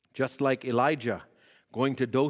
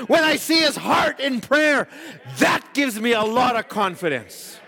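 higher, ringing exhortation building to shouts; preaching at full force; 11 semitones higher, 6 dB louder than usual. Acted. Preacher warning the church against false doctrines, full-force preaching. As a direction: preach it hard, hammering each when.

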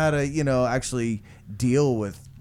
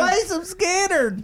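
heckler shout; slower than usual. Acted. Heckling the band.

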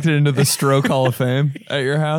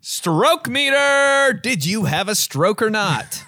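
drawn-out, higher-pitched title call; mock announcer stretching out the game's name; hammed-up show intro; 6 semitones higher, slower than usual.

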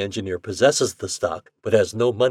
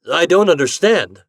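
stammering; 7 semitones higher, 9 dB louder than usual. Acted. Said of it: "he stammered".

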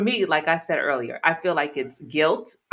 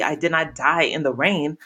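ordinary talk, nothing marked

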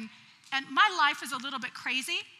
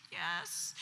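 soft, lower sleepy yes; faked tired voice; 8 semitones lower, 10 dB quieter than usual. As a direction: keep up the drowsy act. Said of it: "I tried to sound really tired".

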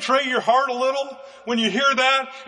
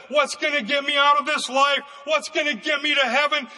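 higher, ringing preacher's cry; preaching at full force; 4 semitones higher than usual. Acted. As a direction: preach it out to the whole church. Preacher builds to a climax.

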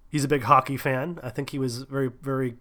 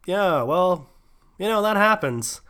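a mildly awkward, higher fumbling reply; retold squirming reply, a little higher; 6 semitones higher, 5 dB louder, slower than usual. Retelling his own awkward answer to the bassist, mildly awkward.

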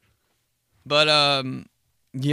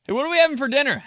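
asking deaf grandma; 12 semitones higher, 6 dB louder, much faster than usual. Retold or imitated.

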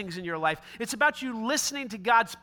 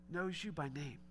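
hushed emphasis; 7 semitones lower, 16 dB quieter than usual. Real, not acted. Preacher stresses point quietly.

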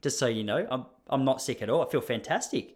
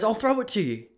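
postman's offer; 8 semitones higher than usual. Retold or imitated. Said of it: "He goes".

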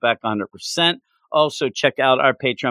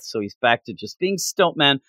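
ordinary talk, nothing marked

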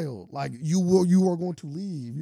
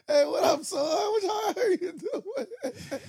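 hammed-up stepdad greeting; much slower than usual. Joking stepdad hails his new son, hammed-up high greeting. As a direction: greet him in a playful high holler. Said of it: "if I would have married, like, LeBron James' mom".

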